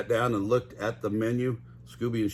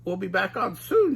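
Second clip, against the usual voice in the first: lightly voiced Shaggy, calming; mildly higher Shaggy comforting Scooby; Shaggy reassuring; 7 semitones higher than usual. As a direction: tell your dog it's fine. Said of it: "Shaggy told Scooby".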